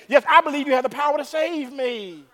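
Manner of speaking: high-pitched acted plea; desperate believer. Dangling man affirming God's power, acted desperate plea. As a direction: cry the answer up to the sky.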